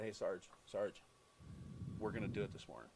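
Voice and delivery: low hushed tip-off, slowed, "Sarge, Sarge"; hushed aside. Retold or imitated. Radio host hushing the plan to his partner on air, low and secret. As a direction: lean in and hush it to your partner.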